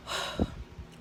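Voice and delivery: soft, voiceless sigh; acted sigh of composure; calming exhale. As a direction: let out a quiet breath to settle down. Calming herself down in retelling.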